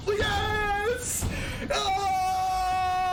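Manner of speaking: excited yell. Real, not acted. Huge play lands for streamer.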